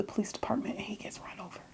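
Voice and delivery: hushed, breathy retelling of the shock; whisper. Real, not acted. Vlogger whispering how Calvin was run over. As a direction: whisper the awful twist.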